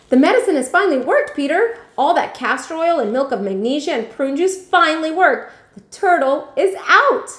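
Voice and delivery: high and loud, bursting with relief; excited news. Acted. Grandma to Peter, high and excited. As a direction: share the happy news at full pitch.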